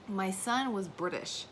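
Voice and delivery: usual speaking voice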